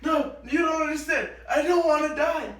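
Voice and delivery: high, loud retold cry of a scared kid; panicked child yell. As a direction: yell it like a frightened child begging mom.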